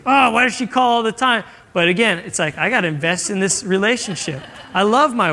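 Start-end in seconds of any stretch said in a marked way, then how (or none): 0.0-1.4 higher-pitched mock exasperation; irritated. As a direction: complain in acted irritation. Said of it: "I'd be getting so irritated".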